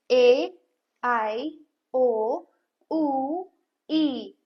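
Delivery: each vowel said alone, clearly; vowel sounds repeated. Shown as a demonstration. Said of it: "ए sound कैसे pronounce करने हैं?". (स्वर दोबारा साफ़ बोलें)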